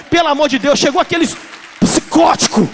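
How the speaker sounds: loud, high mock cry of alarm; preacher mimicking professors yelling in alarm; mock panic yell. Mock terrified professors shouting.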